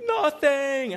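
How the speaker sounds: put-on little girl denying. Daughter denying she ate anything, high childish voice. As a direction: squeak out a childish denial. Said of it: "And she was like".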